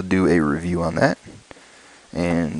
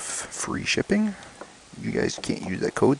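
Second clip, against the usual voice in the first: whispered aside; 5 semitones higher, 6 dB quieter than usual. Real, not acted. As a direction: murmur it as you read. Reading flyer aside.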